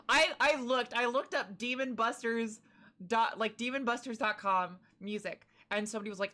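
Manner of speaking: usual speaking voice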